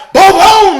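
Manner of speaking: loud, laughing boom, boom; shouted boom. Real, not acted. Describing the gunshot to viewers, laughing shout. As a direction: belt out the gunshot.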